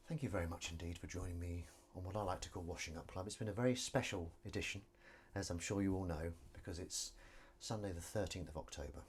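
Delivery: lightly hosted, low, soft sign-off; host your own little show, half-serious; understated presenter voice; mock show host.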